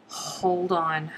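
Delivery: ordinary talk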